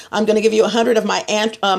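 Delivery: usual speaking voice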